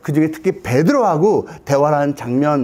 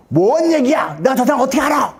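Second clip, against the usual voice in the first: high, protesting mock outburst; feigned outrage at being accused; indignant denial; 7 semitones higher than usual. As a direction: act out an affronted brush-off. Acted indignant denial by accused Peter.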